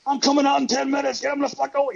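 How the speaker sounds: high-pitched retold yell at a guard; mock yell. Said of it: "yelling at the security guard".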